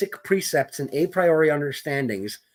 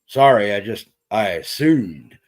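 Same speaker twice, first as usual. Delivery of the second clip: put-on southern good old boy accent; 5 semitones lower, 5 dB louder than usual. Podcaster imitates small-town sheriff in thick southern accent. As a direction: lay on a hillbilly southern accent, apologizing. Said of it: "he's got this really thick ... good old boy accent".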